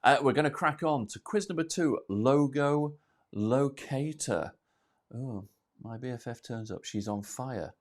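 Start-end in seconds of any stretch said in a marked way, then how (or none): none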